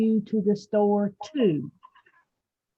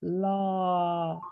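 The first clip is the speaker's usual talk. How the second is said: super slow sleepy sloth; 3 semitones lower than usual. Shown as a demonstration. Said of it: "do it how a sloth would say it".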